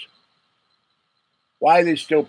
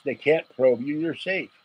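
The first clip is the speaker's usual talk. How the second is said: ordinary talk, nothing marked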